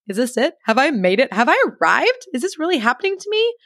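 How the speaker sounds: high, rising incredulous questions; mock-awed, pitch lifting at every question end; playful disbelief. Acted disbelief at first class luxury.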